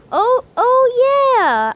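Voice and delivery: high, slow, delighted realization; dawning joy. Acted. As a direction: let the joy sink in slowly. Realization of flying.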